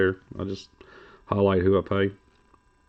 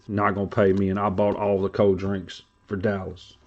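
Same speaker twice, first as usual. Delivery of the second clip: ordinary talk, nothing marked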